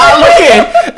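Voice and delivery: high laughing yell, half shouted; mock shriek of shock. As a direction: blurt it out loud through laughter. Retold friend's shocked outburst.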